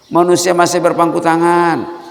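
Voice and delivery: high, loud "Manusia masih berpangku tangan"; loud rebuke. Acted. Preacher loudly rebuking idle people. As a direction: hammer it out from the pulpit.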